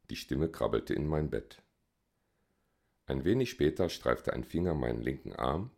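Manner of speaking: ordinary delivery, nothing marked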